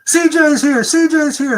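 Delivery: high, loud excited squealing. Retold or imitated. Office girls greeting the author, loud excited squeal. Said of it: "all the girls in the office go".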